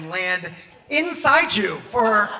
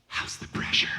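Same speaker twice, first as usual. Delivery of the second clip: whispered right by the face; imitating a therapist's creepy whisper; close-up whisper; 3 dB quieter than usual. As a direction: whisper it softly into someone's ear. Put their head down by your face.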